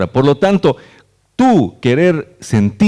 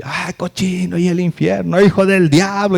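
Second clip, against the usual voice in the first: higher mock hellfire preacher; mocking a condemning preacher, higher; harsh preacher; 6 semitones higher than usual. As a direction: condemn the sinner, hammed-up. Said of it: "prédicas de otros".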